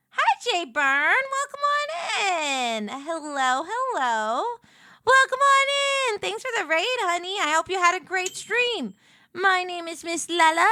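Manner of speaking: high, bright greeting to stream raiders; sweet waitress welcoming customers; diner waitress. Acted. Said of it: "I'm a nineteen fifties diner waitress".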